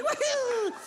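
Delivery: very high whoop of joy; bill cheering. Acted. Five dollar bill cheering with friends, high whoop. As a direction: whoop like a party.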